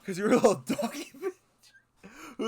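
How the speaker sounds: fast, giggling read; laughing through it. Real, not acted. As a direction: try to read it but keep cracking up. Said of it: "I can't even say it".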